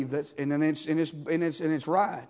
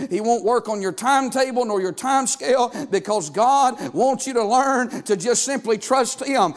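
higher and louder sermon drive; full-force preaching; 6 semitones higher, 6 dB louder than usual. Acted. Pastor pressing the church to wait on God, preaching loud. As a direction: press the point hard, like a revival preacher.

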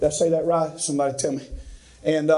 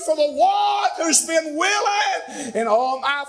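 much higher, slow, ringing shout that drops back at the end; hallelujah shout; 8 semitones higher, slower than usual. Acted. Preacher crying hallelujah to the congregation, an exultant shout. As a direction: shout it out, then settle.

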